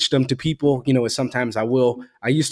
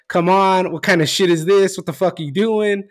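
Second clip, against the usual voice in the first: higher-pitched mock sideline yell; retold screaming from stands; 6 semitones higher than usual. Son imitating his dad at the game, retold screaming. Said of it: "screaming from the stands".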